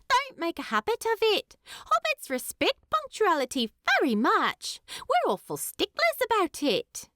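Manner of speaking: high, fast, prim hobbit lass teasing; Primula. Acted. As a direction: rattle off the rules like a proper young hobbit. Primula lectures on punctuality.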